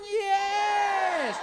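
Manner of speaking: high, shouted "Yes!"; crowd shouting yes. Retold or imitated. Fired-up crowd shouts back to the speaker, high loud yes. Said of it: "Siyempre sabi".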